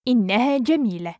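quick, flowing and joined up; fast model sentence; natural speed. Shown as a demonstration. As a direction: run the phrase together fluently. Teacher repeats phrase at speed.

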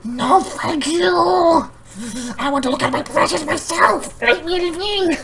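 high, slow, shrieking "my precious" creature; Gollum-like shriek. Retold or imitated. Customer losing it at the clerk, high shrieking creature. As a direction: snap back like a guarding creature. Exploding refusal.

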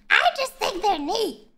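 high put-on Marge voice; Marge impression. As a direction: channel Marge, earnestly. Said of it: "that's Lois or Marge".

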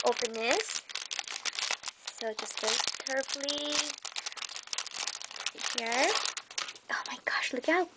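hushed awed whisper. Real, not acted. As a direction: breathe it out as if not to disturb the paper. Whispering over the opened gift.

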